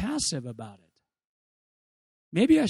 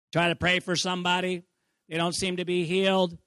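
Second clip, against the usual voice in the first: ordinary delivery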